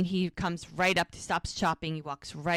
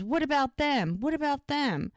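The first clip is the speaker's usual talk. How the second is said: higher-pitched mock indignant protest; pissed off; 7 semitones higher than usual. Podcaster voices the arrested man accusing others, indignant. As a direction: protest like someone caught, lightly acted. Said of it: "'Cause he's pissed".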